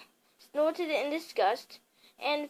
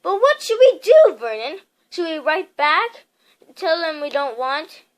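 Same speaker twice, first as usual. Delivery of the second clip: anxious wife; 2 semitones higher, 9 dB louder than usual. A narrator's reading. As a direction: plead for a plan. Petunia anxious.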